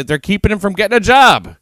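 high-pitched, loud mock indignation; mock outrage. Host jokingly defends the attacker to his co-host, mock outrage.

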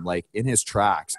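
ordinary talk, nothing marked

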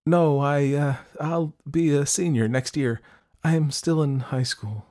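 high, hesitant admission losing force; sheepish, faltering confession; trailing off. A narrator's reading. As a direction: start firm and let it fade. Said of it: "Her voice trailed off".

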